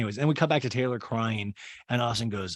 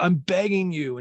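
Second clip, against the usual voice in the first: louder, higher plea, leaning on BEGGING; mock desperate begging, pitched up; insistent pleading; 7 semitones higher, 4 dB louder, slower than usual. [name] begs Taylor to talk, insistent mock plea.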